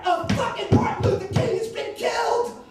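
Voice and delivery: high, loud cry of alarm; shocked announcement. Acted. Aide breaks killing news to Kennedy, shocked shout. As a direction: blurt out the terrible news at full voice.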